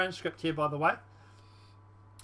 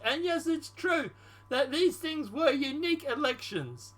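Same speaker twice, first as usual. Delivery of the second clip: higher put-on voice, acted transcript reading; Ukrainian president role; 5 semitones higher than usual. Congratulated leader thanking the American president, as a higher-voiced Ukrainian president. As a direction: play the Ukrainian president, pitched up. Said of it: "the Ukraine president".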